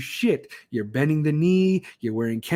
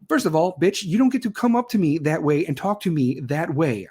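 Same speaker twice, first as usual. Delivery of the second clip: ordinary delivery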